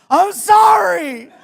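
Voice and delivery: high loud drawn-out wail, slow; panicked apology. Retold or imitated. Guest caught in the bathroom, wailing a high slow apology. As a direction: wail the apology in mock terror.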